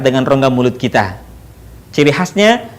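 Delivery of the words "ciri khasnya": ordinary talk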